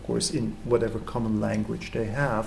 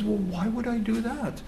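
higher, softer lazy fisherman voice; fisherman shrugging off advice; 9 semitones higher, 5 dB quieter than usual. Retold or imitated. Fisherman answering the German tourist, higher and softer, unbothered. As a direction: toss it off like a man in no hurry.

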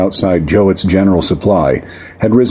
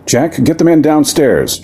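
firm order; 8 semitones higher than usual. Acted. Jorgensen giving orders.

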